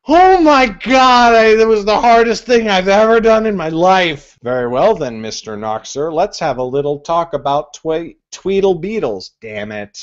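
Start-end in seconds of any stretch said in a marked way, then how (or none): 0.1-4.4 loud, breathless relief after finishing; relieved exclamation. Real, not acted. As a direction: blurt it out, hugely relieved. Relief at finishing the hardest twister.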